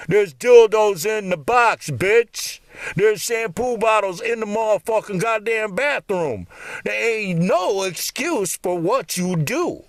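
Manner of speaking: shouting accusations, slow and heavy; furious rant. Real, not acted. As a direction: tear into her with contempt. Ranting at the cheating wife.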